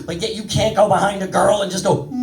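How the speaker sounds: ordinary talk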